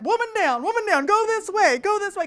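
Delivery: loud, high urgent call of the lift operator; lift operator shouting. Retold or imitated. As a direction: bark out the warning like a lift attendant. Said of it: "The ski lift operator's like".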